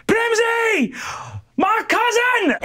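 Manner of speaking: high cry for her cousin; yelling for Primsy. Acted. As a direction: call her name out, way up high. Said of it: "yells out for Primsy".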